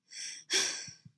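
voiceless panting breath; breathy pant. A narrator's reading. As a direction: let out an audible pant. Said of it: "she panted".

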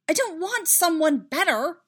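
high, loud protest; emphatic, exasperated rejection; exclaiming. A narrator's reading. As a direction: exclaim it, cutting in. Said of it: "Padme exclaimed".

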